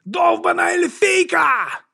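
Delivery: very high, drawn-out cursing yell; shouting. A narrator's reading. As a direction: yell it in outrage, stretched out. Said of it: "закричав хтось".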